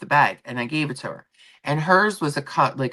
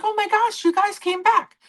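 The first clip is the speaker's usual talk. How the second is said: imitating the bartender's much higher, delighted greeting; gushing high voice. Vlogger imitating a delighted waitress greeting returning regulars, much higher. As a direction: squeal it up high like a thrilled waitress. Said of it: "She's like".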